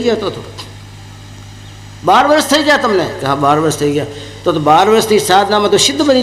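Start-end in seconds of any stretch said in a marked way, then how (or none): 2.0-3.0 higher astonished question; wondering guru. Acted. Guru asking the disciple again, amazed and higher. 3.2-4.1 meek disciple. Acted. Disciple confirming to his guru, low and meek.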